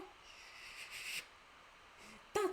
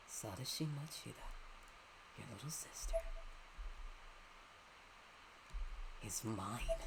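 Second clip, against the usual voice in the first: low, soft, sinister villain; 7 semitones lower, 13 dB quieter than usual. Acted. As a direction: taunt them quietly. Voicing villain in game.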